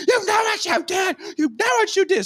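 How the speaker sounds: very high mock screech; angry accusation. Girl accuses the man she hit, imitated high fury.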